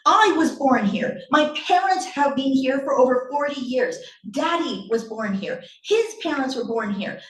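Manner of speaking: worked-up telling-off; yelling mother. Acted. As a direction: hammer each fact home at the child, raising the voice. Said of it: "I'm sorry for yelling".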